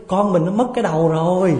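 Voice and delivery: high, loud panicked call to husband; wife jolted awake, calling out in mock panic; startled wife. Wife waking at night cries to husband, mock panic. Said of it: "giật mình thức dậy".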